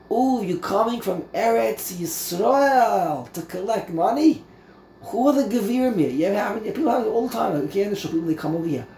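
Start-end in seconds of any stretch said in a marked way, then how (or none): 0.1-6.1 classic collector. Acted. Townsfolk sizing up a visiting fundraiser, mock-excited collector talk. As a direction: play up a nosy fundraising collector. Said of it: "this is like the classic collector".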